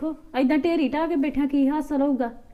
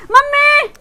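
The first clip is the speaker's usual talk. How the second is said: calling out; 14 semitones higher, 9 dB louder, much slower than usual. Acted. Daughter calls mother in panic.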